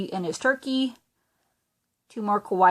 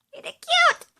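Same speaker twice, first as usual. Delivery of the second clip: much higher, squealed with delight; squealing cute; 4 dB louder than usual. Real, not acted. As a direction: squeal over the cute little thing. Gushing over dancing leprechaun.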